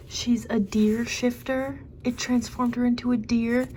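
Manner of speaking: conspiratorial whisper. Real, not acted. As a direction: whisper it like a secret you're sure of. Whispered theory about the Hind.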